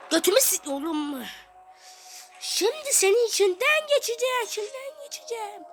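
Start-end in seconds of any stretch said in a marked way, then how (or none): 2.4-5.6 squeaky villain voice. Acted. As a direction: play a tiny furious villain. Oyunda kötü karakteri seslendiriyor. (ince sesli, sahte öfkeli kötü karakter)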